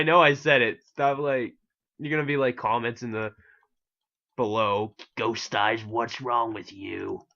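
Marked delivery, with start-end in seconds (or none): 5.2-7.2 lightly voiced pirate, drawn-out ending; middle-aged pirate. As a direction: imitate viewers as a slow pirate, lightly done. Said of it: "middle-aged pirate".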